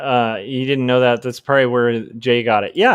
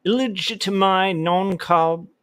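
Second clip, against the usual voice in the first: put-on lightly posed British explorer accent, slow; a hint of a colonial British gentleman; 7 semitones higher than usual. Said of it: "sounding like a pith helmet wearing colonial British gentleman".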